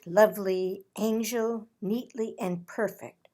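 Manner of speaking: ordinary delivery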